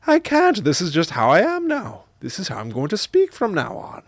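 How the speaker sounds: high, loud mock Irish mother; imitated Irish accent. Mom refusing child's plea, high mock Irish accent. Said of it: "speaking with an Irish accent".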